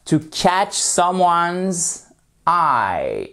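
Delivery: slow model phrase. Shown as a demonstration. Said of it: "slow model phrase".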